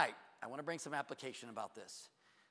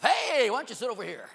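calling out; 10 semitones higher, 14 dB louder than usual. Acted. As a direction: holler a friendly interception across the room. Calls out to intercept visitors.

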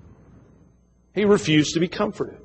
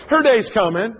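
usual speaking voice

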